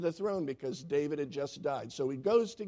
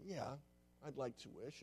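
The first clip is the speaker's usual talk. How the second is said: softer, half-whispered yes; hushed wife; 3 semitones lower, 17 dB quieter than usual. Acted. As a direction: answer quietly, almost to herself. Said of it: "Do you still want to wish?".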